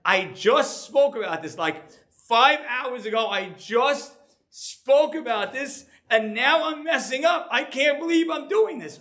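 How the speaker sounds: high, exasperated self-scolding; frustrated with myself. Retold or imitated. Rabbi scolding himself at home, exasperated and high. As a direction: groan at your own slip, pitched well up. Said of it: "I'm getting a little frustrated".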